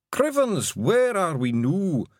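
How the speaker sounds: Scottish pixie voice; high, broad Scottish little man; Daft Willie. Acted. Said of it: "said Daft Willie".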